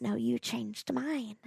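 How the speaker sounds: Lindsay tearfully thanking the village women. Retold or imitated. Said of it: "with tears streaming".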